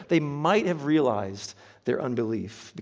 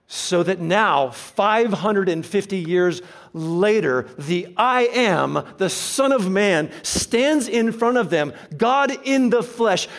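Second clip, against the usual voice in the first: louder, higher, building sermon voice; preaching at full force; 5 semitones higher, 7 dB louder, slower than usual. Acted. Preacher building to a climax about Jesus, louder. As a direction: drive the point home to the congregation.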